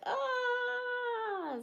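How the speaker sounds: a sudden high strained wail of an overloaded brain; high frazzled wail. Retold or imitated. Streamer mocking her stressed brain while moving house, high frazzled wail. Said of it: "my brain is like".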